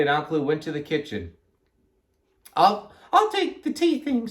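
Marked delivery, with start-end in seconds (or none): 2.5-4.3 high-pitched character voice; Amelia Bedelia. A narrator's reading. Amelia Bedelia to Alkaloo, high character voice. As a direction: say it up high at normal volume. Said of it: "said Amelia Bedelia".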